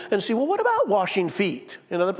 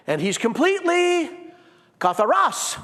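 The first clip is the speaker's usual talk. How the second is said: normal talking voice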